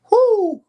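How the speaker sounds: high loud whoo; excited whoop. Real, not acted. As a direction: let out a hyped whoop. Hyped over Fury loss.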